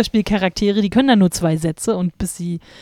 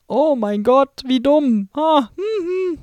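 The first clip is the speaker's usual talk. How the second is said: mock dismay; 7 semitones higher, much slower than usual. Real, not acted.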